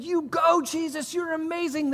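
very high put-on gushing cheer; hammed-up proud parent voice; mock cheering parents. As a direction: squeal mock praise like delighted parents. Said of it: "they don't talk like that".

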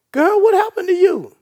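loud high astonished cry; astonished friend. Acted. Friend shocked at the changed believer, loud and astonished. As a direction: shout it like you can't believe the change.

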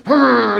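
"Arr!" as a strained grunt through pain; mock grunt. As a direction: grit it out with a short grunt. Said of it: "grunting and bearing it".

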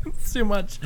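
laughing. Real, not acted. Laughing at her own dilemma.

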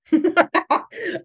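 high burst of laughter; real laugh. Real, not acted. Coach laughing at how the doubts flood in. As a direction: laugh out loud, real and unforced. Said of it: "And I'm laughing".

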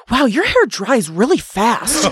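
put-on slow, high, amazed kid voice; childlike wonder. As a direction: say it like an awed child. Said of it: "I was like".

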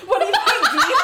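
squeaky high teasing voice; mock Sam. Friends mocking Sam's clumsy line, squeaky high teasing. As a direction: parody a stiff actor asking Dean.

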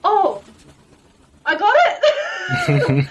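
high, loud, drawn-out mock squeal; elated disbelief. Hunter retelling hearing her moose was found, elated disbelief. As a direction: re-enact a stunned, squealed burst of joy. Acts out joyful reaction to news.

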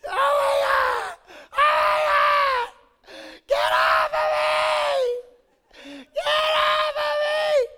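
put-on very high, loud, slow shrieking child's panic; screaming little sister. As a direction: shriek for help like a trapped girl.

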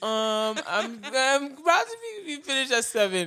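high-pitched, stalling imitation; amused, hammed-up hesitation; mock hesitant reply. Acting out the answer on phone.

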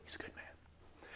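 slow hushed whisper. Retold or imitated. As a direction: murmur it behind a hand. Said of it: "they have to whisper it".

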